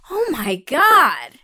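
drawn-out stunned oh my God; slow shocked gasp. Retold or imitated. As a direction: let it sink in slowly. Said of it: "I was like".

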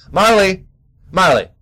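high, loud call to a dog. Real, not acted. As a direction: call out sharply to stop him. Calling the dog sharply.

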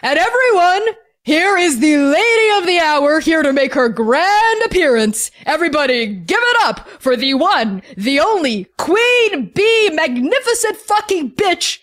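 booming high-pitched hype announcer; amplified DJ. Acted. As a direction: stretch the words and build to a drawn-out name. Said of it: "hear the D J's voice magically amplified".

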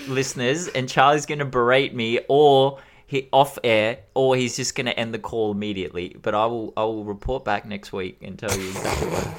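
ordinary talk, nothing marked

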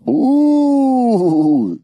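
high rising ooh; surprised ooh. Real, not acted. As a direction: let out a loud surprised ooh. Reacts to circumcision answer.

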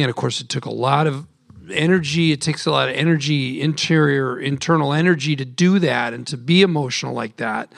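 ordinary talk

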